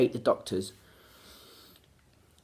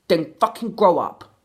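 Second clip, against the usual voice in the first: angry; 3 semitones higher, 6 dB louder than usual. Real, not acted. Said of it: "pissed off now".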